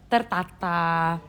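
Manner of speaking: drawn out, very slow and orderly; slow measured Indonesian delivery. Shown as a demonstration. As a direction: say it slowly, neatly, Indonesian style. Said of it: "orang Indonesia ngomongnya tu kan kayak pelan".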